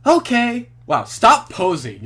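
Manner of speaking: sudden high, loud outburst; loud exclamation. Real, not acted. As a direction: blurt it out loud. Reacting loudly to the game.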